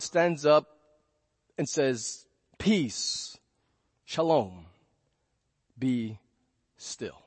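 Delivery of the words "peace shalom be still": calm command. Retold or imitated. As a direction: settle the sea gently with long pauses. Jesus calms storm, retold slowly.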